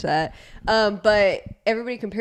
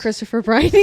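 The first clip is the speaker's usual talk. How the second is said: "Christopher Briney" lifting into a question at the end; 3 semitones higher, faster than usual. Real, not acted. Asked for the actor's name, answers "Christopher Briney" like a question. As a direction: say "Christopher Briney" as an unsure question, half laughing. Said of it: "saying it like a question".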